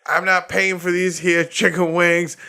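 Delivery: high, loud hillbilly-ish defiance ending in a yell; mocking Florida man. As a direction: ham up the defiant guy. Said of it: "And he was like".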